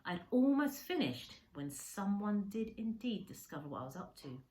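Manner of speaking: ordinary delivery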